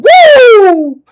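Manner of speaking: high loud whoop; excited whoop. Real, not acted. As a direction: let out a happy cheer. Cheer at pitcher name.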